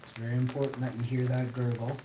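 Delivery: ordinary delivery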